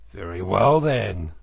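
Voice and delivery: loud, resolute, end of discussion; firm. A narrator's reading. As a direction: announce the decision with force. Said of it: "said the Badger firmly".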